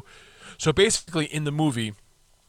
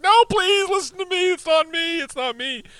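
much higher, louder frantic plea; desperate pleading; 5 dB louder than usual. Retold or imitated. Nick Cage begs his family, a frantic high plea. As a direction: beg them to believe you, pitch cracking high. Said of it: "Nick Cage is like".

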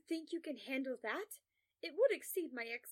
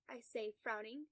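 usual speaking voice

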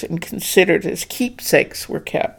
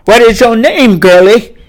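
louder and higher, booming; 5 semitones higher, 10 dB louder than usual. Acted. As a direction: boom the question down at her. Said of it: "he boomed".

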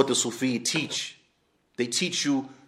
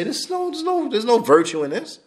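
a sharp, much higher rebuke; stern rebuke; 10 semitones higher than usual. Retold or imitated. Companions correcting a man who faints hearing Quran, sharp and high. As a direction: scold him like a Companion setting him straight.

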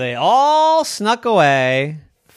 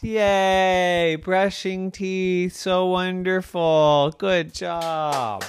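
slow, drawn-out higher cheering; dutiful praise; 6 semitones higher, much slower than usual. A narrator's reading. Family applauds the child for brushing teeth, dutiful. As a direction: stretch out each word of praise.

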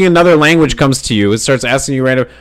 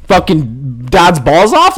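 ordinary talk, nothing marked

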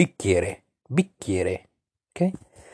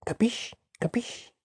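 quizzical Italian check; 12 semitones higher, 9 dB quieter, faster than usual. Shown as a demonstration. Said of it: "you would say".

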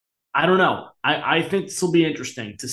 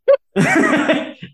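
high, loud real laugh; sudden high cackle of delight; loud burst of laughter; 4 dB louder than usual. Real, not acted. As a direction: crack up at the joke. Laughing at Cowherd's take.

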